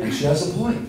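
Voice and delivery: usual speaking voice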